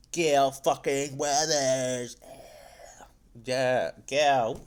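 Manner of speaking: slow, drawn-out fan announcement; triumphant hype. Real, not acted. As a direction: announce a favourite hero with relish. Hypes a favourite character's entrance.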